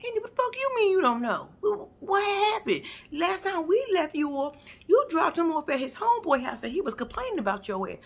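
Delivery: high, loud exasperated yelling; exasperated shout. Retold or imitated. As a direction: yell at her in disbelief. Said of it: "I said".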